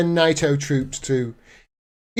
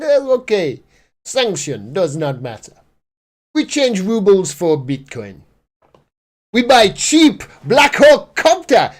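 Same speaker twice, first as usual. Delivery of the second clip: hammed-up Russian accent, halting phrases; bad Russian accent; 4 semitones lower than usual. Commentator playing Putin shrugging off sanctions, bad Russian accent. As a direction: put on a badly faked Russian accent. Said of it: "That's my worst Russian accent".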